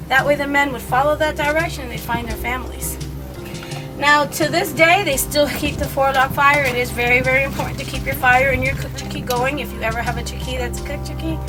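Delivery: ordinary talk, nothing marked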